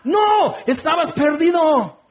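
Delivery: emphatic shout. Real, not acted. Preacher shouting at listeners that they were lost. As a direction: shout the correction at the congregation. Preacher rebuts the spa idea.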